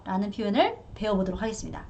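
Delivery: normal talking voice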